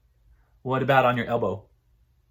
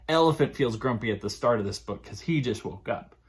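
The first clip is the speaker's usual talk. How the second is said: plain reading voice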